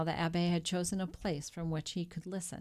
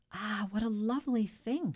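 higher, brighter gasp of delight; delighted exclamation; 5 semitones higher, 3 dB louder than usual. A narrator's reading. Wife admiring the diamond, exclaiming in delight. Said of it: "she exclaimed".

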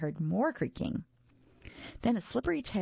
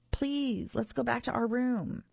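put-on higher-pitched child's plea; beg, leaning on the "please"; imploring, pitched up; pleading; 7 semitones higher than usual. Child pleads with his monster.

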